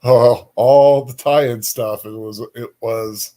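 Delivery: ordinary delivery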